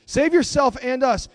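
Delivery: loud, emphatic repeat of a taunt; mildly railing read-aloud voice; railing criminal. A narrator's reading. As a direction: throw it out as a challenge from scripture. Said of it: "railed at him".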